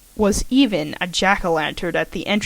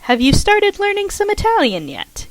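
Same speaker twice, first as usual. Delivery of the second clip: Karen; 11 semitones higher than usual. Acted. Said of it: "Karen asked".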